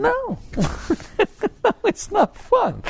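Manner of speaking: high, slow, through a laugh. Real, not acted. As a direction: answer it laughing at the idea. Laughing answer to his question.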